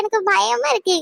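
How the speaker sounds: normal talking voice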